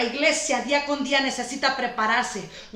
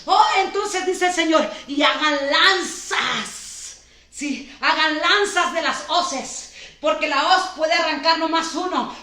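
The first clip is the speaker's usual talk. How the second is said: preaching loud and fast, building to shouts; prophesy over the church at full force; fervent preaching; 4 semitones higher, 4 dB louder than usual. Acted. Pastor prophesying to the congregation, fervent preaching.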